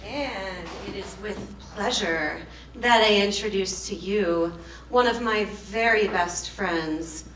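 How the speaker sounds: slow, grand stage introduction; burlesque host. Acted. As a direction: announce the next act with a flourish. Host introduces next act.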